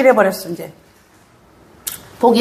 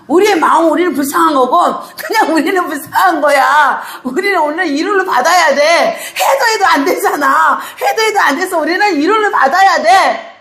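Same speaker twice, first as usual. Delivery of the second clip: impassioned pulpit preaching, higher and pressing; preaching at full force; 7 semitones higher than usual. Acted. Pastor urging the congregation in full preaching voice. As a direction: drive the point home to the congregation.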